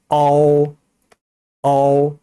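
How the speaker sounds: 어우 held long, eo gliding into oo; careful diphthong. Shown as a demonstration. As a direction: model 어우 slowly, opening unrounded then closing to oo. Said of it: "요 발음 주의하세요".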